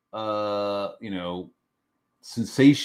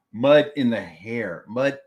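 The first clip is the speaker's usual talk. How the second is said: ordinary delivery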